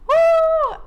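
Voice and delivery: high, loud whoop to let emotion out; emotional woo. Real, not acted. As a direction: whoop loudly through welling tears. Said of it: "why am I crying".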